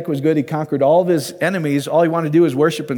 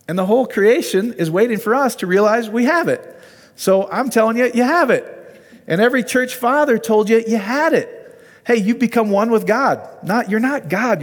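higher-pitched, swelling preacher's fervor; fervent preaching; 7 semitones higher than usual. Real, not acted. Preacher urging listeners they have it, fervent and high. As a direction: lift the whole run up like a rising altar call.

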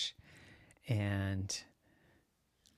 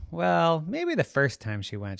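ordinary delivery, nothing marked

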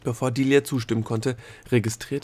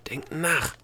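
higher, pressed hiss; urgent, hissed command; impatient hiss; 6 semitones higher than usual. A narrator's reading. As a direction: hiss it impatiently at a slow thinker. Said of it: "zischte der Gnom".

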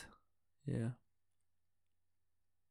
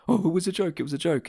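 mocking Obama; 7 semitones higher, faster than usual. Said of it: "you know".

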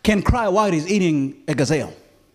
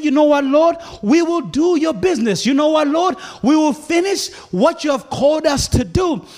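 determined vow; 6 semitones higher, 3 dB louder than usual. Retold or imitated. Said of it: "They should have said".

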